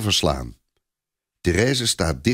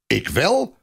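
higher, indignant snap; a soldier bristling at the insult; snapping retort; 9 semitones higher than usual. A narrator's reading. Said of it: "snauwt Clopin".

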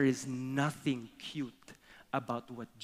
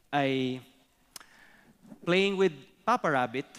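normal talking voice

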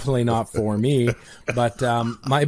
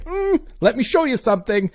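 smug retort; 9 semitones higher than usual. Pretend. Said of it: "reply to this app and say".

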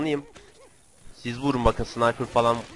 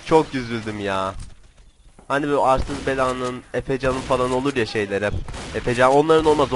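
ordinary talk

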